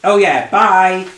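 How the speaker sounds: high, loud, slow goodbye; hammed-up cheery bye; chirpy sign-off. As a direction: sing out a drawn-out farewell. NPC letter sign-off.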